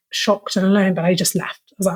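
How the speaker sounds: ordinary talk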